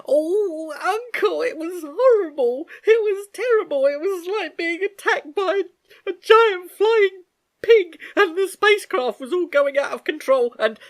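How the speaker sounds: crying in distress; tearful. Acted. Girl telling her uncle the nightmare, tearful. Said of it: "burst into tears".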